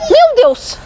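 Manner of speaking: high startled gasp of the words; playing at panic over a missing toy baby, mock surprise. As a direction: act shocked the baby is gone.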